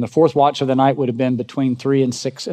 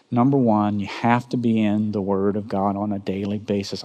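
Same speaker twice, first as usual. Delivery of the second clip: ordinary talk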